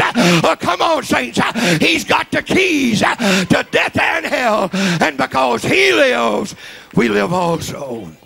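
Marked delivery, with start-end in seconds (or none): 1.8-8.1 full-force preaching. Acted. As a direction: preach it to the rafters. Preacher climax shout.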